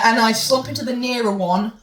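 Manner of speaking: ordinary delivery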